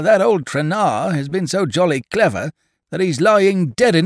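high, loud, mocking rant of an old farmer; scornful old farmer. Farmer scoffing at the stranger.

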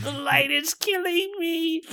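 very high, anguished wail; pained shriek. Acted. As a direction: cry out as the sun burns. Vampire burned by daylight.

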